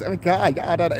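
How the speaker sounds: lightly mock the work voice. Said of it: "you go to work talking like this".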